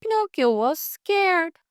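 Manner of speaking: ordinary delivery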